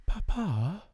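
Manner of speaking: put-on a small girl asking her father; little girl's voice. A narrator's reading. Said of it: "she said in a low, mysterious little voice, which was almost a whisper".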